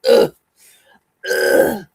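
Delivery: two loud, rough groans of frustration; exasperated ugh. Pretend. Streamer grumbles about the devil's shenanigans, exasperated groans. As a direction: grunt "ugh" twice, separately and forcefully. Groans at bad luck with bills.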